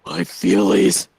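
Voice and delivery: low breathy whisper, slow; hushed pretend sob; whispered mock hurt.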